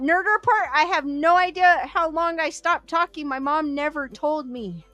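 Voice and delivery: ordinary talk, nothing marked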